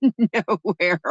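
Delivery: laughing the word out; amused laugh on the word. Real, not acted. Laughs mid-sentence.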